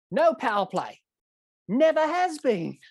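high, drawn-out imitated refusal; mock emphatic ruling. Umpire ruling over the stump mics, mock emphatic refusal.

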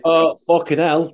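loud, high, drawn-out groan of dismay; a real pained outburst; sudden dismay. Real, not acted. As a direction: blurt it as the memory hits. Suddenly remembers upsetting event.

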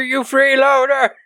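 put-on old man shouting a bird off the window; ranting at a crow. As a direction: shoo the crow away in a hammed-up rant. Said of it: "while he's ranting".

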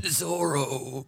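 lightly voiced old man; a hint of a creaky old man. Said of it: "I can't do an old man voice".

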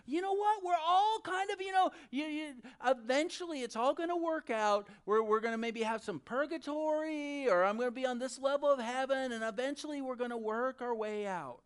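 high, loud, rambling mock optimism; mocking universalist. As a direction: parody someone airily talking it all away. Acts out universalism's argument.

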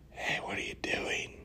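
hushed whisper from close behind; sneaky, very soft whispered question. Retold or imitated. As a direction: whisper it like a playful tease. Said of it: "talk to her while whispering".

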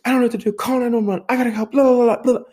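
panicked scream. Retold or imitated. Daughter retelling mom's panic, imitating a high frantic shriek. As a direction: shriek it out, helpless and overwhelmed. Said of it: "she's screaming".

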